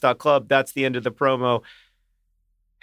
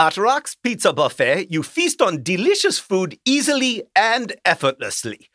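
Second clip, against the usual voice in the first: put-on German accent; 3 semitones higher than usual. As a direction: read the ad copy as a theatrical mock German. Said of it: "this is a German accent".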